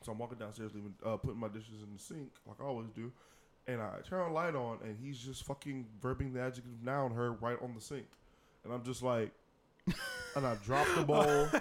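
normal talking voice

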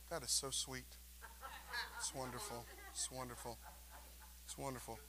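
very soft, flat, unimpressed murmur; flat mock "it's wonderful" with no excitement; mock deadpan. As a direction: sound totally underwhelmed by a gift. Mock flat response to a million dollars.

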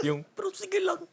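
breaks into high giggles mid-sentence; laughing. Real, not acted. Friend catches himself and giggles high through the words.

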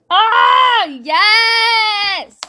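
a big, high, loud whoop of delight; excited cheer. Real, not acted. Vlogger cheering for the video clip, a loud excited whoop. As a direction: cheer her on at the top of your voice.

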